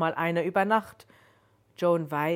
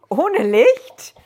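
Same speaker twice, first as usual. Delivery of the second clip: startled question pitched way up and much louder; far too high; 9 semitones higher, 14 dB louder than usual. A narrator's reading. Joan, scared by the stranger in the dark, asks in a shrill high squeak. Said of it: "in immer noch viel zu hohem Ton".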